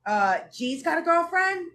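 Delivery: mock shocked grandma asking; grandma at the table, hearing the news, surprised. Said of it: "she goes".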